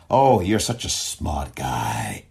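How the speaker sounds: slow, dripping sarcasm; mock the judge with fake admiration; drawn-out mock compliment; sarcastic praise. Real, not acted.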